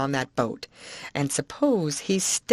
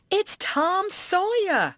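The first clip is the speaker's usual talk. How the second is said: much higher, slow proud reveal; triumphant aunt; 13 semitones higher, slower than usual. Acted. Aunt to husband, much higher slow triumphant reveal. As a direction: announce it grandly.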